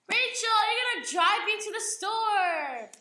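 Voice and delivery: loud, high-pitched call with a drawn-out end; booming dad. Retold or imitated. Dad telling his nervous daughter to drive, booming. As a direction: holler it like a dad announcing orders.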